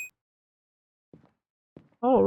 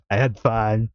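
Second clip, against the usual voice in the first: ordinary talk, nothing marked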